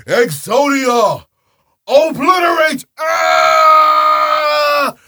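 huge high shout, Exodia stretched slow; summoning shout. Pretend. Summoning Exodia to win the duel, screaming.

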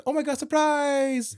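high, slow, drawn-out surprise shout; spring a surprise on the kids; dad rat springing a surprise, high and drawn out; mock surprise. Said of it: "He's like".

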